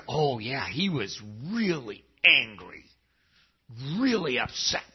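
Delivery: lightly voiced, knowing preacher-friend tone, imitated; a hint of smug certainty, imitated; mocking the Baptist crowd. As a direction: play the know-it-all churchgoer, a little mocking. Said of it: "people in the Baptistic realms ... said".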